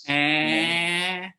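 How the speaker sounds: "Eh" stretched into one long vowel. Shown as a demonstration. Showing the Valley's long vowels, "Eh" drawn right out. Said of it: "all the long vowels".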